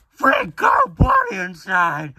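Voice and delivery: loud, high-pitched squealed slogan; shouted box slogan. Acted. Cereal box announcing a free toy, high shout. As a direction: shout it like an overexcited toy advert. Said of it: "The box said".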